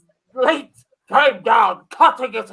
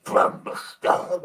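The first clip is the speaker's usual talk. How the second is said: spooky whisper; 6 dB quieter, slower than usual. Acted. Narrator echoes the line.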